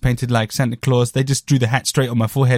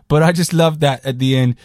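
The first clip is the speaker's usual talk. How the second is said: ordinary talk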